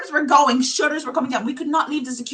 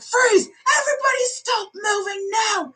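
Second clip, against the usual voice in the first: much higher shouted freeze command; retold shout; 9 semitones higher than usual. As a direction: yell it like an armed guard. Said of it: "they're like".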